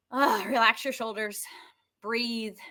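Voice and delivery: ordinary talk, nothing marked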